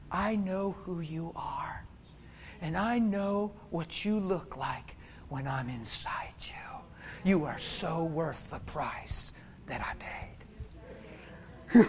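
slow, very soft whisper; tender whisper. Acted. Jesus speaking to the one he died for, whispered. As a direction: breathe it slowly, as an intimate reassurance.

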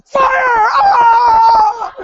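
very high, loud, drawn-out yell; hammed-up shout trailing into a long scream; mock revivalist scream. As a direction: erupt into a wild comic shriek. Sudden mock prayer shout after softness.

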